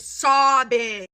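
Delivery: SOBBING loud and drawn out; emphatic exaggeration. Real, not acted. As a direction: stretch and punch the word sobbing. Emphasising how hard girl cried.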